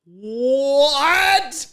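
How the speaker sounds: high, rising, drawn-out disbelief; mock shock. Owner spotting lizard tail in cat's mouth, mock shock. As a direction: stretch it upward in acted amazement.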